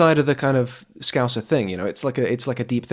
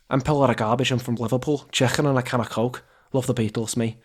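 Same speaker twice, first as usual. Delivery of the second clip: throaty put-on Scouse accent; Liverpool accent; 4 dB quieter than usual. Shown as a demonstration. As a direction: do a Scouser, rasping the "k" in Chicken. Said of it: "this one's for the British listeners".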